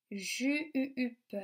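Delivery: "ju-u-up" with the French U pulsed; teacher drawing out "ju-u-up" on its U; stretched vowel. Shown as a demonstration. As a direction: hold and repeat the U in "ju-u-up".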